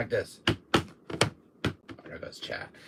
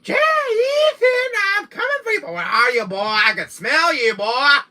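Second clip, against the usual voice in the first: put-on Jack's Louisiana accent; 9 semitones higher, 5 dB louder than usual. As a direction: call out for the hidden Ethan in a Louisiana accent. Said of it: "this Louisiana accent".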